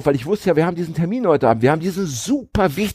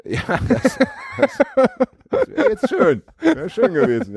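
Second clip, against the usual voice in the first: dreamy; 13 semitones higher than usual. Real, not acted. Wistful sigh about holiday.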